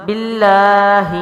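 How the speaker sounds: باللہ with doubled heavy ل and long a; tajweed recitation. Shown as a demonstration. Teacher modelling the word, firm slow recitation. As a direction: press the ل in باللہ firmly and hold it. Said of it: "تو کیا ہوگا؟".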